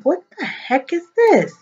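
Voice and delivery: high, incredulous squeal; baffled quote. Retold or imitated. Pregnant vlogger retelling her itching, high baffled squeal. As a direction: raise the pitch in mock disbelief. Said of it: "I'm like".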